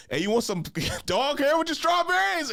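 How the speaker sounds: high, hammed-up pushy vendor voice; mock seller. Retelling a seller pushing dog-hair strawberries, high mocking voice. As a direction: pitch up and sell it like a sleazy vendor.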